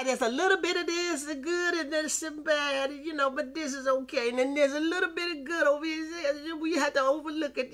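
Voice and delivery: sing-song imitation of folks who carve up the word; mocking compromisers. Preacher mocks compromisers who carve the word, high sing-song.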